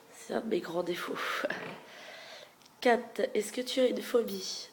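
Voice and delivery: ordinary delivery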